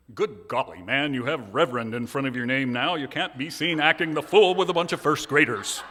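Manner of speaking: loud, stuffy British scolding voice; British accent. Retold or imitated. Minister voicing his inner critic in a loud British accent. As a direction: scold yourself like a prim British schoolmaster. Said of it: "my shaming thoughts have a British accent".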